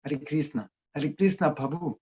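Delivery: Chinese-accented "Krishna" as "Klisna"; "Hare Krishna Prabhu" with Chinese-style missing r's. Shown as a demonstration. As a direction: mimic a Chinese speaker struggling with r in "Prabhu". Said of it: "R is not in their language".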